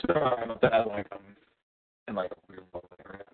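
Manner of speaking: quiet, warbling bad-signal audio; faint and wobbly, like weak reception; jitter warble. Real, not acted. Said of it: "it's still warbling".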